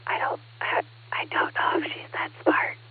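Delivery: whispered aside about her daughter. Real, not acted. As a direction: drop to a secret whisper, like a confession. Confiding doubt about toddler.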